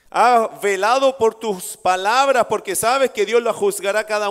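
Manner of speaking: loud, driving preacher's questions; fervent preaching. Acted. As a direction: press each question on the congregation. Opening rhetorical questions, preaching hard.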